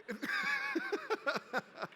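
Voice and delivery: big high burst of laughter. Real, not acted. Big laugh after the bit.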